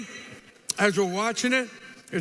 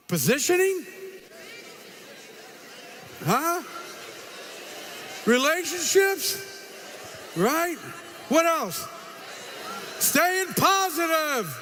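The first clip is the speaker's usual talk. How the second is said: rousing recap; 6 semitones higher, slower than usual. Real, not acted. Speaker quizzing the audience on the day's lessons, rousing. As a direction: pump up the crowd with each answer.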